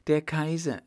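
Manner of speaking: put-on girl caught kissing cries out at the Kaiser's entrance; startled cry. A narrator's reading. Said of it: "rief Bertha in tiefstem Schreck".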